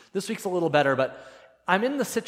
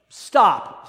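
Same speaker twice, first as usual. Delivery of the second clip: loud, higher acted order; sharp command; 6 semitones higher, 10 dB louder than usual. Wife halting her climbing husband, loud sharp command. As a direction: cut him off with one loud word.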